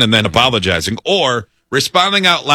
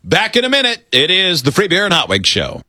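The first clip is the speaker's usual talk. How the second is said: higher-pitched radio host patter; radio show announcer; 5 semitones higher than usual. Real, not acted. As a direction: announce the show and station to listeners. Host does show break and station ID.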